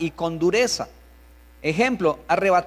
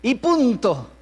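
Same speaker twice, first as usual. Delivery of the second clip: authoritarian parent; 4 semitones higher, 3 dB louder, much slower than usual. Acted. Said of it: "le añaden algunos padres".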